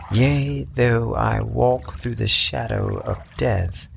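slow, high solemn scripture recitation; mock biblical prophet. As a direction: intone it like a psalm. Recites Psalm over the book.

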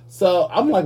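normal talking voice

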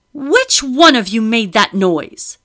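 loud, high, slow enraged demand; furious demand. A narrator's reading. Madam rounding on the girls: loud furious demand. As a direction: bark it out in rage. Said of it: "Her face flushed with rage".

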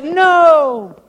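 put-on high, loud little-boy voice saying no; emphatic kids. Sunday school boys answer the teacher, emphatic and high. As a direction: play the boys' firm answer. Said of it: "they all said".

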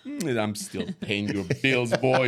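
father warns his son, mock stern; mock stern dad. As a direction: put the kid in his place, hammed up. Dad answering kids, mock stern.

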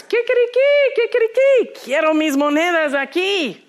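high, loud defiant crowing; rooster crowing. Acted. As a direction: crow it out like a furious little rooster. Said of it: "So he starts".

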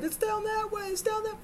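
very high, thin mock scream; screaming kids. Terrified kids giving directions to bleeding driver, high mock screaming. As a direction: squeal directions in panic without much volume. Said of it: "They're screaming".

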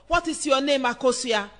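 ordinary delivery

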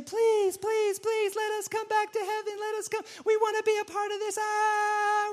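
hammed-up high wailing beg; mock sinner falling into hell, high pitiful wail; mock screaming plea. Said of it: "we're, like, screaming".

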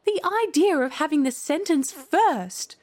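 high-pitched, swooping indignation; indignant. A narrator's reading. Alice objecting to the queen's ruling, indignant. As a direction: protest at the absurdity, pitch leaping.

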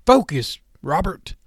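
urging. A narrator's reading. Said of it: "Cypher urged".

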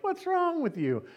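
mock exasperation. Other drivers' exasperation voiced in story.

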